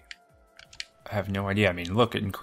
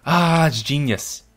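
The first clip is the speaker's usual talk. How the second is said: delighted; 5 semitones higher, 6 dB louder, slower than usual. Real, not acted. Delighted by the strategy.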